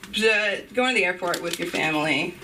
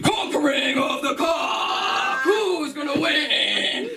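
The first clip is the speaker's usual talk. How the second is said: mock announcer; 5 semitones higher, much slower than usual. Comic to crowd, announcer-style slow shout. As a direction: announce it like a battle.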